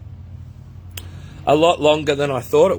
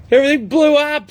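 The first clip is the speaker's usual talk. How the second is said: excited exclamation; 12 semitones higher, 5 dB louder than usual. Real, not acted. Software failed at live start.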